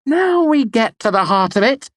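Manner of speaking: very high, loud exasperation; exasperated. A narrator's reading. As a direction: throw it up in the air. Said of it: "she said, throwing her hands up".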